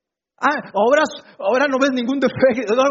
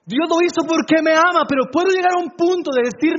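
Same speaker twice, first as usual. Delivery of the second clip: impassioned preacher, higher and driving; fervent preaching; 4 semitones higher, 4 dB louder than usual. Real, not acted. Preacher insists to the congregation that grace was unearned, fervently. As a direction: preach it with rising conviction.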